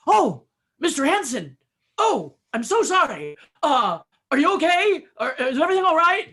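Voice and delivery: loud, high, gushing alarm; hammed-up panicked concern; flustered apology. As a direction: imitate someone horrified and backpedalling fast. Producer's horrified apology to Henson, retold.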